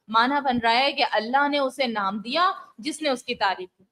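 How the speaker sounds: ordinary delivery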